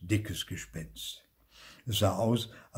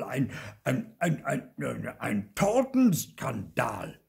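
higher, loud indignant uncle; puffed-up outrage; 5 semitones higher, 7 dB louder than usual. A narrator's reading. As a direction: splutter at the scandal, stumbling on the word. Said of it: "Onkel Oskar blähte sich auf".

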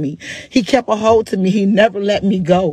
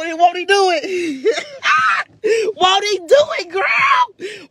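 laughing and crying; 8 semitones higher, slower than usual. Real, not acted. Overwhelmed joy, praising God.

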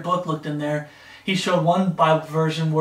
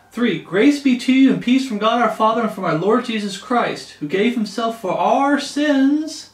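raised, ringing Bible-reading voice; scripture reading; 5 semitones higher, 5 dB louder than usual. Acted. Preacher reading Galatians to fellow believers, proclaiming scripture. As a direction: read the verse out high and loud. Reads scripture aloud to listeners.

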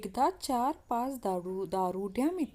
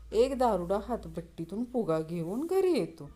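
usual speaking voice

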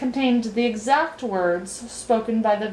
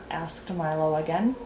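plain reading voice

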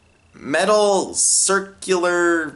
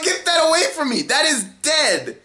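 freaked out; 8 semitones higher than usual. Retold or imitated. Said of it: "I'm, like, screaming".

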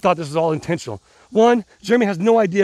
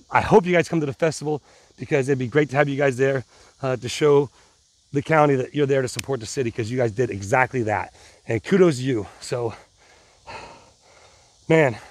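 ordinary talk, nothing marked

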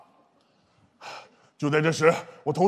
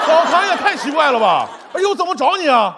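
high-pitched comic disbelief; high, fed-up complaint; incredulous protest; 3 semitones higher than usual. Acted. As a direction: object in exasperation, pitched up. Protests absurd meeting place.

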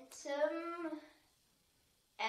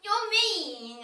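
loud, high teasing protest; playful complaint; 6 semitones higher, 14 dB louder than usual. Real, not acted. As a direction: whine it playfully.

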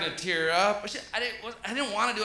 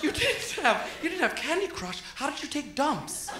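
high, softer mock-kid voice; small high voice of disbelief; astonished great-grandchild; 7 semitones higher, 3 dB quieter than usual. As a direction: play a bewildered child, pitched up. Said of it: "running up to their great-grandfather like".

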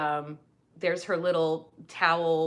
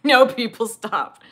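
loud, higher mock protest; exaggerated, raised-voice objection; mock pleading; 4 semitones higher, 11 dB louder, slower than usual. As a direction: call out as a cringing viewer begging them to stop. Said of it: "and you're like".

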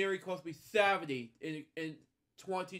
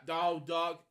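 ordinary delivery

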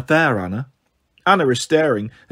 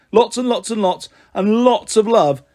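effusive, higher-pitched farewell; gushing sign-off; 5 semitones higher than usual. Acted. Dan ending a second letter to Ellie, gushing. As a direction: pile on the affection.

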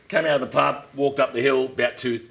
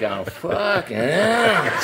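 exasperated groan; 7 semitones higher, 4 dB louder, much slower than usual. Retold or imitated. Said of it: "and I'm like".

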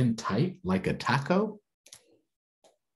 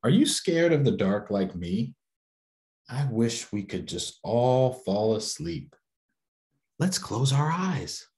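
story reading; 4 semitones higher than usual. A narrator's reading. Reading picture book aloud.

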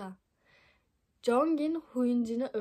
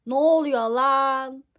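louder, slow, roughly voiced yell; angry shout; 2 semitones higher, 11 dB louder, slower than usual. Han bursts into the room and yells at the couple, loud angry shout. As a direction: bark it like the intruder.

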